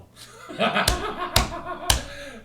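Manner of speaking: long high burst of laughter; high loud laugh. Real, not acted. Larry cracking up at the starstruck fans.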